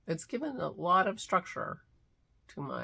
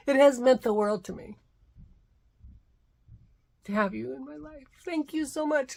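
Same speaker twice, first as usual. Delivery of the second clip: teary, voice rising; 9 semitones higher, 6 dB louder than usual. Real, not acted. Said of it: "And now I'm getting all teary".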